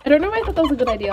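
loud, rushed exclamation. Real, not acted. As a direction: blurt it out loud and fast.

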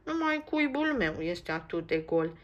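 a touch of a whiny lament; complaining. Acted. Max complains his nest is empty, a touch sulky. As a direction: sulk about the empty nest. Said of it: "se plânge Max".

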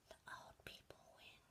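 hushed secret whisper. Acted. Old people winning, whispered secretly. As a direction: whisper it like a conspiracy. Setup before the shouted take.

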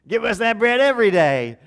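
loud, high eager crowd cry; crowd demanding. Acted. The hungry crowd begging Jesus for bread, loud and eager.

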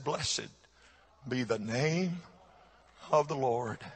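soft, low, halting blessing; retold trembling grief. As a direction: quietly imitate a shaken man pushing through his grief. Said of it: "through trembling, shaking of his body and his lips".

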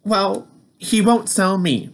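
lightly voiced Peter the truck; Peter's voice. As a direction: voice Peter a bit up. Peter voiced in read story.

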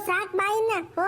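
high tearful plea, voice breaking; crying wife. Acted. Wife crying at her husband to stop, tearful and high. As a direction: sob it out as the worn-down wife.

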